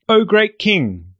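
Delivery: high, slow fawning "O great king"; bow and flatter the king; fawning courtiers. Acted. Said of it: "bowed and said".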